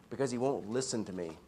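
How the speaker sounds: soft, understated defeat; somewhat defeated. Retold or imitated. Bagheera explains to Baloo why he can't do it, mildly defeated. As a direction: quietly give up, without any anger. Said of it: "He says it in a somewhat defeated tone".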